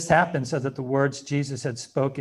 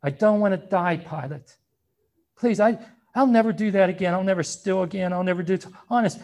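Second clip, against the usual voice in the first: higher, louder desperate pleading; begging for my life; 4 semitones higher, 4 dB louder than usual. Retold or imitated. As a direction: beg frantically for mercy. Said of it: "begged for their lives".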